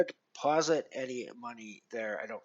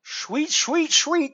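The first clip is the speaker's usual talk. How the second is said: higher, chirpy repeated sweet; delighted sweets; 11 semitones higher, 5 dB louder, much slower than usual. Real, not acted. Streamer thanking chat, chirpy high delight. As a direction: savour it, pleased. Pleased jukebox is back.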